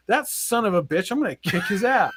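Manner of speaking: hammed-up vow of payback; mock indignation. Concussed wrestler hears who hurt his shoulder, mock indignant. Retold outburst at being injured.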